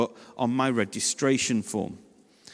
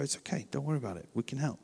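much softer, hushed and soothing; hushed soothing words to a parent who lost their child; gentle reassurance; 10 dB quieter, faster than usual. Shown as a demonstration. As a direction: say it quietly to calm a panicked parent. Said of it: "just go".

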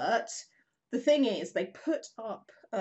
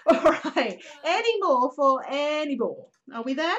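laughing wrap-up; 6 semitones higher, 7 dB louder than usual. Real, not acted.

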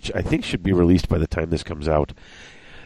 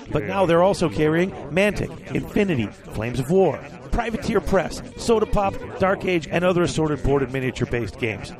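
quiet, higher ad read voice; 8 semitones higher, 4 dB quieter than usual. Acted. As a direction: read it like a hobby store commercial. Reads sponsor ad.

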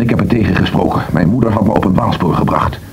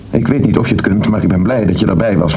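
ordinary talk

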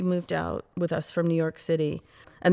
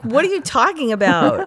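higher, louder disbelief at mom; incredulous reply; 8 semitones higher, 5 dB louder than usual. Retold or imitated. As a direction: retort to mother pushing sorority rush. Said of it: "I'm like".

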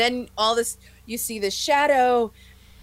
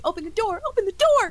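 high-pitched, building urgency; acted-out frantic viewer; urgent pleading; 10 semitones higher than usual. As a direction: plead at the screen, voice climbing. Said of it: "I just keep thinking".

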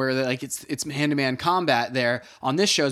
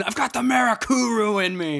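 higher, hammed-up tough-guy declaration; mock outburst; 7 semitones higher than usual. Worker slamming the meeting table, hammed-up tough declaration. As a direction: slam the table and announce it for laughs.

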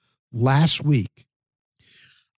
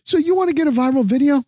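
imitating wife; 12 semitones higher, faster than usual. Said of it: "And she goes".

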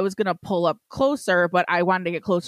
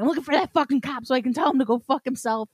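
higher-pitched retold angry rant; all pissed; 6 semitones higher than usual. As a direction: reenact fuming about that cop. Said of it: "like, all pissed".

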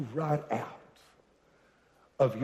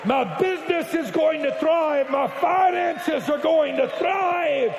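high, loud climbing proclamation; full-force preaching; 8 semitones higher, 4 dB louder than usual. Acted. Preacher rallies the congregation, shouting higher and higher. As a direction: preach it up to a roar.

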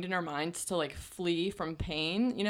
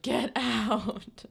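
mock labor yell; 5 semitones higher than usual. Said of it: "like, grunting and screaming".

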